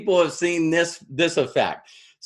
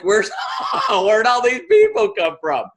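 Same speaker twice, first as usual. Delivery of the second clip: higher, loud amazed cry through a laugh; amazed shout; 5 semitones higher, 4 dB louder than usual. Retold or imitated. Tara marvels at all the new people, laughing and loud. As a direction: shout it out, overwhelmed and delighted. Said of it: "it's like".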